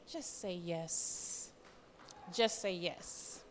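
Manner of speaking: low, slow, soft, faraway; voice of the Lord. Retold or imitated. The Lord urging her to accept, low, slow and soft. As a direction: hush it down like a voice from above. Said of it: "I can just hear the Lord saying".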